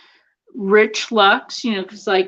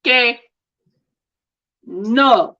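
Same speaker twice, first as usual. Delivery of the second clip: mock Spanish outburst; 3 semitones higher, much slower than usual. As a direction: imitate a worked-up Spanish speaker, stretching it. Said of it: "you'll piss somebody off".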